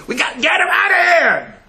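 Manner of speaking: high, loud crying shout; desperate high shout. Retold or imitated. Shelter people begging to remove the pest, high crying shout. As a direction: yell it out in despair. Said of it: "They went to her, they said".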